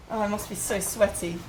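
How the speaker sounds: ordinary delivery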